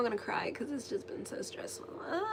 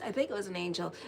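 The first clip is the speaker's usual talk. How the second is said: usual speaking voice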